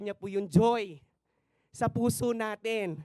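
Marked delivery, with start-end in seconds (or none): none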